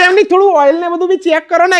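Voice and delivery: high-pitched lady customer voice, lightly done; a woman ordering the mechanic about. Retold or imitated. Woman telling the mechanic to check the oil, in a high voice.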